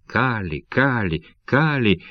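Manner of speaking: usual speaking voice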